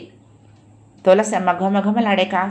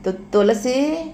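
halting, higher call with a drawn-out end; coughing call; 5 semitones higher, slower than usual. A narrator's reading. Sick husband calls his wife by name, mildly halting through coughs. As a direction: stammer the name slowly, as if through a mild cough. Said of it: "దగ్గుతూనే".

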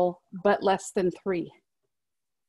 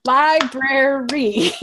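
slow, louder, split-up "Library"; syllable by syllable; 2 semitones higher, 5 dB louder, much slower than usual. Shown as a demonstration. Checking it has three syllables, "Library" said slowly. Counting syllables of own word.

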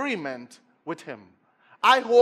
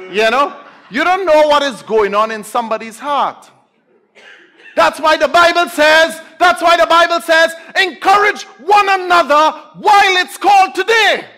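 high shouted exhortation; full-force preaching; 8 semitones higher, 12 dB louder than usual. Real, not acted. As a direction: build to a ringing pulpit shout.

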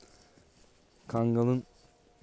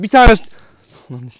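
angry yell; 9 semitones higher, 15 dB louder than usual. Real, not acted. Yelling at dog to let go.

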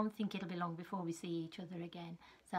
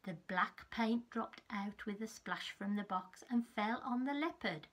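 put-on lively read-aloud for small children; story narration. Teacher reading a picture book to her class. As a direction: tell it like a bedtime storybook.

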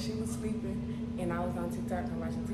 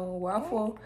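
ordinary talk

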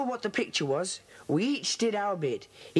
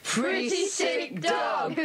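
drawn-out delighted yell breaking into laughter; gleeful shout; 7 semitones higher, much slower than usual. Acted. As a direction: shout it out in triumph, then laugh. Kids gleefully chant the password clue.

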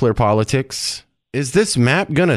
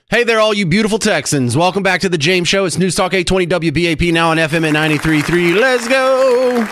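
higher-pitched, brisk radio welcome; radio show opener; 5 semitones higher than usual. Acted. Radio host welcomes Texans back to the show, upbeat opener. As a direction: kick off the segment with high-pitched energy.

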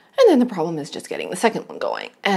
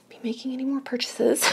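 whispering; 3 semitones higher, 7 dB quieter than usual. Real, not acted. Guilty whispered aside.

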